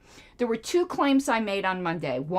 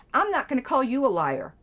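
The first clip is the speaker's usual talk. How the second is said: ordinary talk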